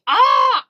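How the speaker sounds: high loud squeal of delight. Real, not acted. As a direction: squeal at the idea. Viewer suggests Louisiana birthday stop.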